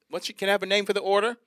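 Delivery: high, quick mock cashier voice; counter girl. Chick-fil-A girl asking for a name, high mock-girl voice.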